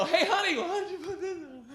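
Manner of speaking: high, playful sweet-talk; coaxing husband. Retold or imitated. Husband offering lingerie to his wife, high coaxing voice. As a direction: coax her teasingly. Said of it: "And then I go".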